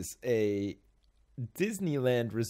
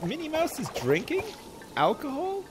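surprised. Real, not acted. Surprised at mug picture.

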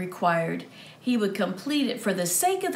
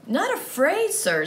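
put-on young squire, voice lifting high; earnest young squire answering; 7 semitones higher than usual. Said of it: "said the squire".

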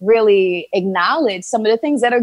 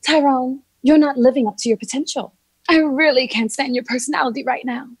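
higher, polite and measured, same insult made prim; proper, restrained phrasing of the same complaint; Caucasian tone; 7 semitones higher than usual. Acted. Monologue character scolds Tyrone in her Caucasian tone, prim and polite. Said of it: "But in my Caucasian tone".

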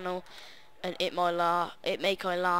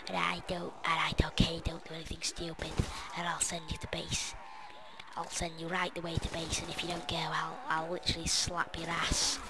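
threatening whisper; 6 dB quieter than usual. Acted. Voicing threatening character.

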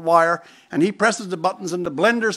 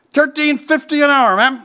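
louder, higher deadpan reply; blunt answer; 6 semitones higher, 6 dB louder than usual. Retold or imitated. Buddy answers the queen about his pay, bluntly and loudly. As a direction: drop the punchline bluntly and loud.

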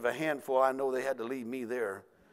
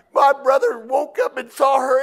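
normal talking voice

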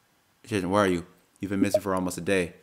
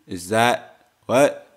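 usual speaking voice

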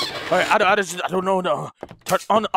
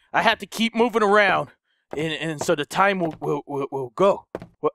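usual speaking voice

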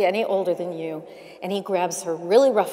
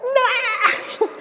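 high, laughing squeal of disbelief; mock shocked cry; 5 dB louder than usual. Trapped in the producer's office, a laughing squeal. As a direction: yelp it out, half laughing, as the door locks. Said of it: "and I was like".

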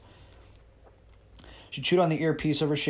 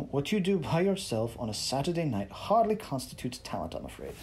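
Brynn's British put-down; 2 semitones higher than usual. Acted. Brynn's cutting British remark.

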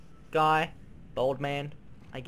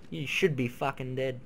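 usual speaking voice

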